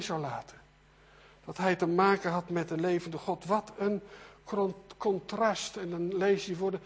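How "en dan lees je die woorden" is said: normal talking voice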